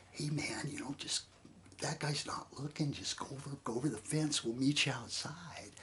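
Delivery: hushed, breathy whisper to slip past the guard; whispered urging. Retold or imitated. Friend whispering to the stowaway to hop the fence. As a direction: keep it secret, whisper the plan.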